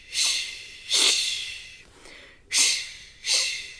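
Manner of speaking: unvoiced sh pulses; sh sounds. Shown as a demonstration. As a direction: breathe out sh in pulses. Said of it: "give me another sh".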